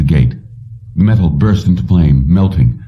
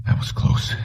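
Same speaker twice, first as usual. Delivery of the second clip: softer whisper of private relief; whispered thought; 10 dB quieter than usual. A narrator's reading. Jennings to himself in the taxi, whispered relief. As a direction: breathe it to yourself.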